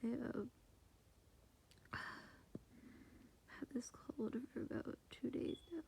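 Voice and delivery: hushed late-night whisper; whispering quietly at night. Real, not acted. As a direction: whisper so the roommate sleeps on. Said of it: "trying to be quiet".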